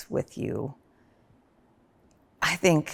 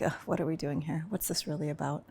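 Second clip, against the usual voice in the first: much softer, gentle curious question; soft wondering; 11 dB quieter than usual. Retold or imitated. Reflecting with husband on fights, soft wondering. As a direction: ask it softly, with care. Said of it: "and be like".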